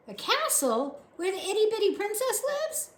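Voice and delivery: put-on high, wondering girlish voice; light up at the princess; eager servant girl. A narrator's reading. Mig asking the soldier about the princess, high and wondering.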